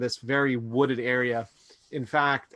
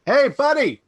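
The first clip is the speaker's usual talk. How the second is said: loud, high shout across the woods; calling out; 13 semitones higher, 9 dB louder than usual. Acted. Daniel hollering at the man far off, loud friendly call. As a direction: yell to get the stranger's attention. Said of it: "He's like".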